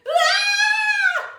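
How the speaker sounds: loud high shriek of fright; scared scream. Acted. Storyteller as little girl hearing the door squeak, scared scream.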